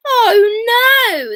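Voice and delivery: loud, high, crying oh no; dismayed wail. Acted. The children finding the breadcrumbs gone, crying out high.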